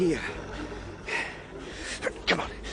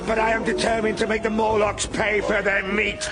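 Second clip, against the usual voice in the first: high, tense resolve; defiant; 8 semitones higher than usual. Acted. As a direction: steel yourself against the horde. Desperate stand against Morlocks.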